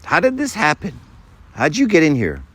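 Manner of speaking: high-pitched, baffled questioning; an angel baffled at who got in; puzzled angel. Acted. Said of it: "the angel says, you know".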